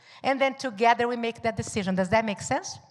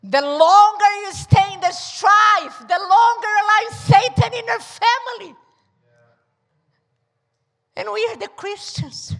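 preaching at full force; 7 semitones higher, 5 dB louder than usual. Real, not acted. Preacher builds to shouted climax.

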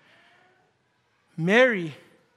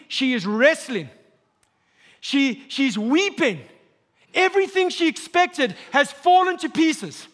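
rising preaching; 6 semitones higher than usual. Acted. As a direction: build the sermon up to a cry. Preacher climbs to full force.

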